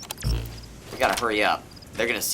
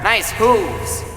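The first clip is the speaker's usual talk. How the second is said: enthusiastic; 8 semitones higher, 4 dB louder than usual. Acted. Praising horse.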